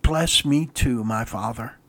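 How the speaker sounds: soft, slow, low plea sinking to a near-whisper on "my father"; anguished plea. Shown as a demonstration. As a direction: let "Bless me too, my father." fall quiet and broken. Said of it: "There isn't enough anguish in my words".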